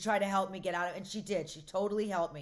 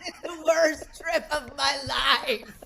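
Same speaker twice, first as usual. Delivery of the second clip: slow, loud, hammed-up horror; mock horrified shout; 3 semitones higher, 5 dB louder, slower than usual. Three days into Lexapro while driving, mock horrified shout. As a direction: stretch it out in a comic holler. Acting out freak-out on the freeway.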